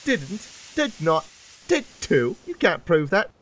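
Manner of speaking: high-pitched squabble; childish bickering. A narrator's reading. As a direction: trade quick contradictions like squabbling twins. Twins bicker back and forth.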